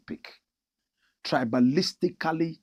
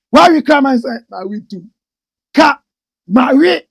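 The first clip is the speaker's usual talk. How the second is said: mocking outburst; 10 semitones higher, 14 dB louder than usual. Complaining, mocking the pronunciation.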